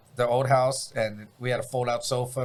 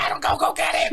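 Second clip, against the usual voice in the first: much higher, pushy voice; high mock dad; 13 semitones higher than usual. Dad ordering his son to fetch the bat, pushy. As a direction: push the kid to hurry.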